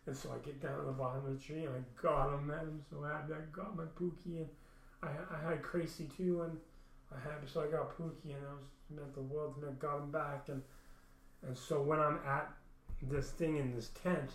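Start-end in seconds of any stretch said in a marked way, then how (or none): none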